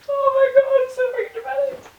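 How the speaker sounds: very high, tearful squeal; real crying. Real, not acted. Overwhelmed by the messy room, really crying. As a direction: break into high-pitched tears. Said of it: "I deserve to be able to fucking cry".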